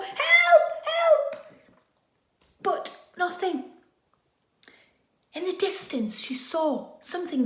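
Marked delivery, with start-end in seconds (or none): 0.0-1.5 high, loud desperate cry; shouted for help. Acted. Girl on the shore yelling to an approaching boat, very high and loud. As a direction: scream it across the water. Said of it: "She shouted from the shore".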